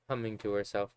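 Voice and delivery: ordinary delivery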